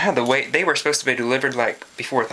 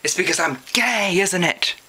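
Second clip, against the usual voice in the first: retold scream; 7 semitones higher than usual.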